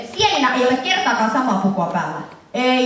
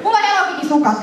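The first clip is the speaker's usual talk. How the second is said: high-pitched, excited outburst; excited defence; 8 semitones higher than usual. Real, not acted. Presenter showing off Hello Kitty socks to a heckler, excited and high. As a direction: pipe up high in delighted defence.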